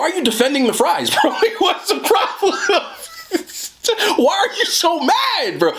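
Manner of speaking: high, laughing disbelief. Real, not acted. Laughing at viewers who defend the fries, giggling. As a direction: crack up while asking why.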